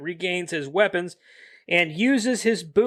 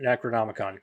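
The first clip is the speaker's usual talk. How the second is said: ordinary talk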